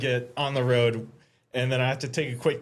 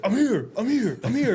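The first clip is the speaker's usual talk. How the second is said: repeated excited call; eager calling out; 7 semitones higher than usual. Retold or imitated. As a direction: announce yourself proudly as you arrive. Friend arriving late, calling out.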